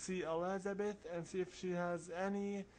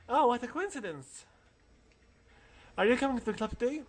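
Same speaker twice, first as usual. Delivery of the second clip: put-on girl voice; 6 semitones higher, 8 dB louder, faster than usual. Voices a female classmate.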